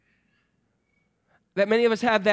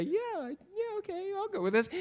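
much higher, soft little voice; happy to go along; 11 semitones higher, 8 dB quieter than usual. Acted. Thomas enjoying looking like Jesus, high and agreeable. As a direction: pitch it way up, lightly and agreeably. Thomas going along when things are good.

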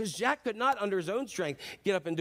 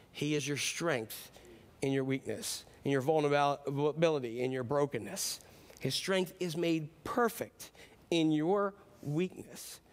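tender preaching; 4 semitones lower, 3 dB quieter, slower than usual. Acted. Soft preaching climax.

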